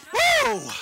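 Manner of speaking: high-pitched "woo"; exultant whoop. Real, not acted. Preacher celebrating the blind man's healing, exultant whoop. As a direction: let out a short, high revival whoop.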